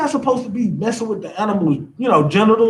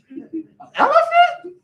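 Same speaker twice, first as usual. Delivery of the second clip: much higher, slow, laughing put-on rapper voice; slower than usual. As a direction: squeal it out high and slow with a laugh. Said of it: "I gotta get in my [name] voice now".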